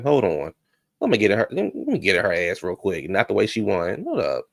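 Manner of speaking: ordinary delivery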